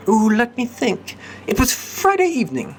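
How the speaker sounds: put-on high, light young lady recalling; high storytelling lady; girlish voice. Cat lady recounts the night.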